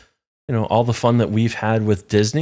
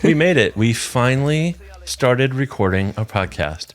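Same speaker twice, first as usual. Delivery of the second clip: usual speaking voice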